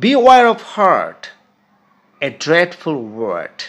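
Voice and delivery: very slow, deliberate classroom recitation; poem recited aloud. Shown as a demonstration. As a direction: recite the verse slowly, phrase by phrase, with weighty stressed words. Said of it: "আমি আগে কবিতাটি আবৃত্তি করছি উচ্চ স্বরে". (উচ্চ স্বরে ধীরে ধীরে কবিতা আবৃত্তি)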